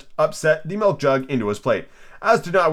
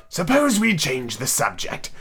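slow, drawn-out yawning voice; lazy, weary hare; yawning March Hare; 3 semitones higher, slower than usual. Acted. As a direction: drag the words through a yawn. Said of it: "interrupted, yawning".

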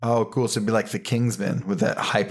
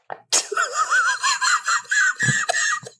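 shrieking laugh. Real, not acted. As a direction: cackle up high at the pun. Laughs at own pun.

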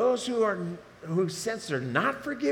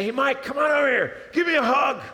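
loud, much higher, calling across the room; God calling out; 6 semitones higher, 7 dB louder than usual. Pretend. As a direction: welcome him home with a big shout. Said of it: "And he's gonna say".

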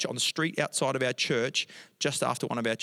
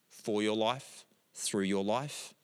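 ordinary talk

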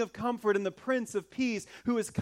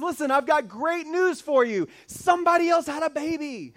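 louder and higher, a triumphant angel's proclamation; grand announcement; 7 semitones higher, 6 dB louder than usual. Retold or imitated. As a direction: proclaim it like a herald, big and bright. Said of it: "and the angel says".

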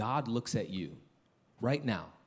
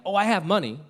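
higher, louder boastful exclamation; mock boast; 8 semitones higher, 9 dB louder, faster than usual. Preacher voicing someone proud of their money, mock boast. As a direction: crow it like a proud owner.

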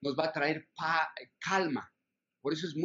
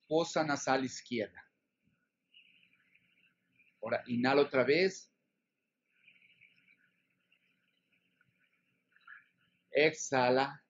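slow guided breathing cues; slow breath counting. Shown as a demonstration. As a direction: coach the breath slowly. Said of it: "Yo te digo cómo".

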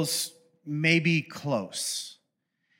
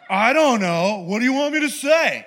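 higher, laughing shrug; careless, who-cares delivery; flippant; 5 semitones higher, 4 dB louder than usual. Retold or imitated. Too-casual angel shrugging at the shepherds, flippant. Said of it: "he's a little too flippant".

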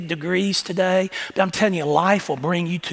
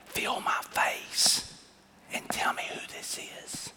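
whisper; 12 dB quieter than usual. Retold or imitated. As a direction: tease him in a hushed voice. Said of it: "I whispered".

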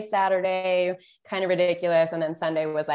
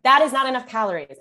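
retold yell; 6 semitones higher, 5 dB louder, faster than usual. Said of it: "I yelled at her".

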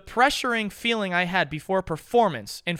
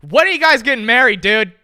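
higher, louder teasing shout; teasing call-out; 5 semitones higher, 11 dB louder than usual. Real, not acted. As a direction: call it out to tease them.

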